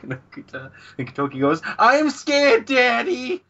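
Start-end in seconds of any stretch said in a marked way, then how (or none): 1.8-3.4 put-on high, loud childish wail; mock fear. Gintoki crying to Daddy about the ghost, mock fear. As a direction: wail like a scared kid, hammed-up.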